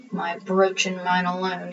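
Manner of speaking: low, soft muttering to himself; muttered. A narrator's reading. Ebenezer alone in the storeroom, softly muttering. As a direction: mutter it under the breath, greedy. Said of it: "he muttered".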